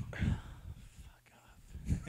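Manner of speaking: breathy whispered brush-off. Real, not acted. Host dismisses co-host claiming to be [name], whispered. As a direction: sigh it out under breath.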